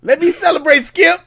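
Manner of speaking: high, loud mocking imitation; mock Shannon Sharpe. Mimicking Shannon Sharpe's plea to Skip, high and loud. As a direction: shout it like the showman host. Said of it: "He said".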